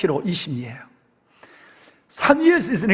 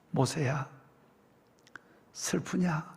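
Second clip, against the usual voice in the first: a hushed, gentle divine question, much softer; soft voice of God; 11 dB quieter, slower than usual. A narrator's reading. God gently asking weeping Moses, hushed and tender. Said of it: "하나님의 소리가 들려왔어요".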